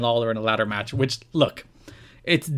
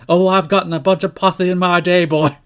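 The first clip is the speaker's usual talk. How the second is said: higher, louder drawling Dusty Rhodes voice; Dusty impression; 5 semitones higher, 6 dB louder than usual. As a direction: ham up the old wrestler's Texas swagger. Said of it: "And then Dusty responds".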